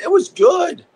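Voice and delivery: high, delighted shout; excited shout. Real, not acted. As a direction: shout it out with joy. Excited shout about the song.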